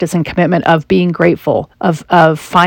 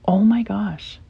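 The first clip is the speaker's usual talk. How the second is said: soft whispered disbelief; whispered wonder at a new home; awed whisper; 3 semitones higher, 8 dB quieter, slower than usual. Retold or imitated. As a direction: gasp it out in quiet wonder. Awe walking in Costa Rica.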